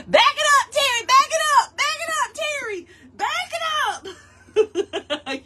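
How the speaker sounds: high, loud call. Real, not acted. Calling to Terry across the yard, high call.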